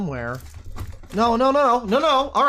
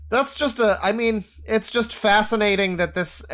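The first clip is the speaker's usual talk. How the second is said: usual speaking voice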